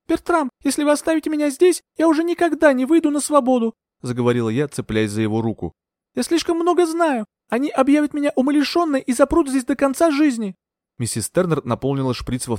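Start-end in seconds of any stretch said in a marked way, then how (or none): 0.1-10.5 lightly voiced young woman, pleading; earnest heroine begging to help a friend. A narrator's reading. (героиня с мольбой)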